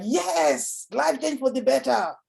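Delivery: high, bright uplift on the good news; excited rise. Shown as a demonstration. Speaker coaching on speech delivery, showing an uplifted excited line. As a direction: lift the voice like a rising story. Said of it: "is like a rise".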